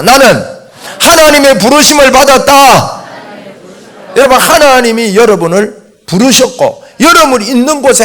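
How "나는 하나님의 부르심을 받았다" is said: high, loud lead for repetition; loud declaration. Shown as a demonstration. Preacher leading the congregation to repeat after him, loud declaration. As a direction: proclaim it for the congregation to echo. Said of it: "한번 따라합시다".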